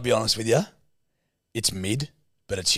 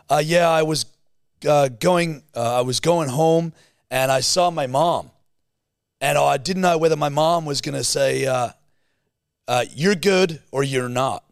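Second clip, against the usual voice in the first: put-on American-Australian hybrid accent; 5 semitones higher, 5 dB louder than usual. Mocking Americanized Twitch streamers, hybrid American-Australian accent. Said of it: "weird hybrid accent of American and Australian".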